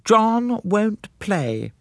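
high, slow, put-on wife's voice; dismissive wife. A narrator's reading. Wife answering for her husband, high dismissive drawl. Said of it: "said Missus Clapperton".

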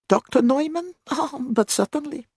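put-on very high, accented character voice; high-pitched foreign gatekeeper; obliging gatekeeper. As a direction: answer in a high, eager-to-help pipe. Gatekeeper answers the visiting doctor.

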